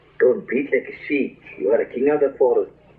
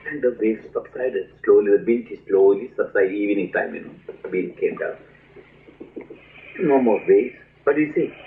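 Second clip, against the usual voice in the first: ordinary delivery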